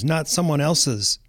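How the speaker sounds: ordinary talk, nothing marked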